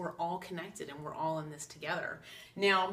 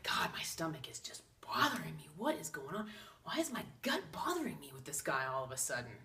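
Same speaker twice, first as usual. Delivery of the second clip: hushed unvoiced whisper, quick at the end; whispered inner thought; 3 semitones lower, 6 dB quieter than usual. Wondering to herself about her aching gut, whispered. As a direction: breathe it to yourself like a secret worry.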